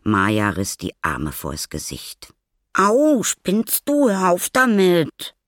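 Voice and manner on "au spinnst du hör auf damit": high, loud, drawn-out protest; indignant yell. A narrator's reading. Maya pelted with walnuts by the boy, indignant yell. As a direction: yell out in pained outrage, pitched way up.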